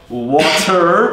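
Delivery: 'water' drawn out very slowly, T softened to a D; flapped T water. Shown as a demonstration. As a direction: stretch 'water' slowly and flap the T. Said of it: "making the T's into D's".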